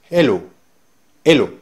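hello with no H at all, said ello; dropped H. Shown as a demonstration. Teacher demonstrating Jamaican patois, dropping the H. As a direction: drop the H of hello, start on the vowel. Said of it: "en lugar de decir hello".

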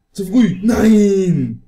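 loud dismayed cry; frustrated shout. Real, not acted. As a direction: yell out in frustration. Fish lost, too early.

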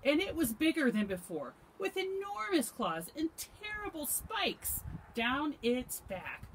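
high, urgent storybook narration; dramatic story narration. A narrator's reading. Reader describes the monster to children, dramatic and high.

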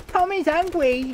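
put-on high babyish taunt; mock baby voice. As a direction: talk about yourself like a sulky kid. Mocks himself in third person.

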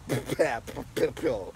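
short embarrassed laugh; sheepish giggle; laugh at a slip. Real, not acted. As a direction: laugh off the stumble. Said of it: "I did really bad that time".

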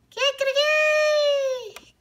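high, slow stretched crow; high drawn-out cock-a-doodle-doo; rooster crow. A narrator's reading. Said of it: "cantó la gallina".

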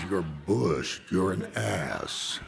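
low, deadpan rebuke; scornful director. Retold or imitated. Director rebuking the bushed actor before hanging up, scornful. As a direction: drop low and slow down on the insult.

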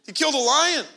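ordinary talk

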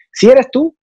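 "eres" pitched high, rising; rising stress on eres. Shown as a demonstration. Actor stressing identity in a role, eres rising high. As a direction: lift "eres" sharply up. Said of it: "no eres tú".

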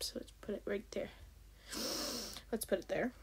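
soft, fast whispered aside. Real, not acted. Whispering while moving the character in the app. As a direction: whisper it to yourself. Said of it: "whispered aside".

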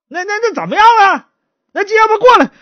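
high, loud order; exasperated magistrate. Acted. Magistrate calling the stutterer over, exasperated. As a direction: demand it impatiently.

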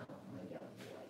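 hushed little aside to her dog; whisper to the dog. Real, not acted. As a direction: breathe it to the dog under your voice.

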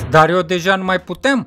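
plain reading voice

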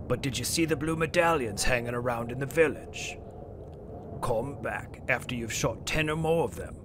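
lightly voiced sly shopkeeper; merchant voice. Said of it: "I'm gonna do my best merchant voice here".

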